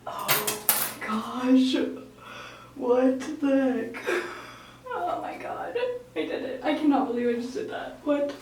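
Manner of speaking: stunned, breaking into laughter; real shock. Real, not acted. Vlogger having just cut her bangs, real shock. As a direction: gasp out disbelief through nervous laughter.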